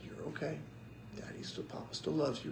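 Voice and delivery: quiet, comforting parent voice; retold gentle comfort, hushed at the end; tender reassurance. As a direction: softly soothe a crying child. Father comforting his crying son.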